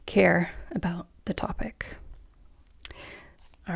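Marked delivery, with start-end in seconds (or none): 0.1-1.8 whispering. Shown as a demonstration. Lifeless delivery demo trails off.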